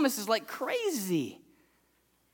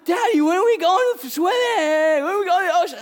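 put-on eager little girl; 9 semitones higher, 6 dB louder than usual. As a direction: whine it up high like an impatient kid. Daughter voiced, pestering.

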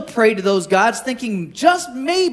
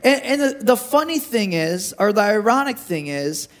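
ordinary talk

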